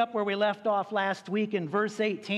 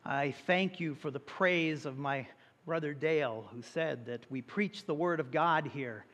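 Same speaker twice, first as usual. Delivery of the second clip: soft, earnest prayer voice, quieter than his preaching; 4 semitones lower, 3 dB quieter than usual. Acted. Pastor opening the service in prayer, soft and reverent. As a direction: pray it gently and reverently to God.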